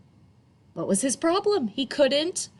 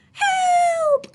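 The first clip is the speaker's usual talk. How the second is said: much higher, louder shout; high panicked yell; cry for help; 8 dB louder than usual. Acted. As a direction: call out in panic as he splashes. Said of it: "cried Flip Flop".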